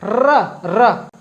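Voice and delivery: र rolled, tongue tip vibrating against the palate; trilled r demo. Shown as a demonstration. Teacher shows students how the र is trilled. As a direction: roll the र so the tongue flutters. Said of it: "vibrate हो रही है हमारी जीभ".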